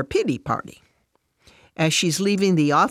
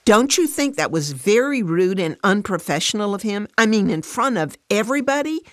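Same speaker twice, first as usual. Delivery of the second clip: higher, indignant appeal; frustrated; 6 semitones higher, 4 dB louder than usual. A narrator's reading. Fran complaining to Alice about her boss, frustrated. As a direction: push for agreement, exasperated. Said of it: "Fran says in frustration".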